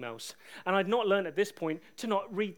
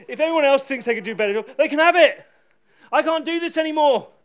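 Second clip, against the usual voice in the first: higher, very loud desperate shout; 8 semitones higher, 14 dB louder than usual. Retold or imitated. Preacher retelling his late-night prayer in the park, shouting out. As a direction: yell it to God at full force. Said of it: "just shouting out".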